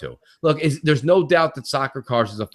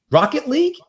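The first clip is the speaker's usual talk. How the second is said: much higher, questioning mock voice of the guy; mimicking the clueless guy; 12 semitones higher than usual. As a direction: play him as a clueless guy, pitched way up. Mimicking the guy's clueless answer.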